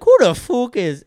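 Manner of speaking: put-on high, loud, slow Irish accent; Conor McGregor. Retrying the fighter's catchphrase high and loud in an Irish accent. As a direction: jump up high into a failed Irish brogue. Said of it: "'Cause he's pretty high pitched".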